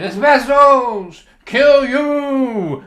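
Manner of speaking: high, drawn-out happy bellow; echoed drunken toast. Retold or imitated. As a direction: shout it back as a toast. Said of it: "And he said".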